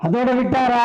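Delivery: high, projected platform call; ringing orator's challenge; rousing rhetorical question. Real, not acted. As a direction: throw the question out to the crowd. Repeats question loudly to rouse crowd.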